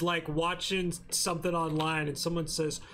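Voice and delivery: ordinary talk, nothing marked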